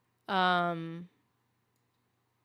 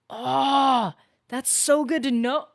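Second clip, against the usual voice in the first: loud, higher-pitched burst of gratitude; big excited "oh", then loud delight; real delighted relief; 5 semitones higher, 10 dB louder, slower than usual. Real, not acted. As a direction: exclaim it, thrilled to finally know. Viewer supplies the answer she sought.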